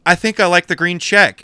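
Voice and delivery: high, loud, over-careful choice; mock nervous. Scared buyer choosing under the maker's eye, mock nervous. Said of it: "I was so scared".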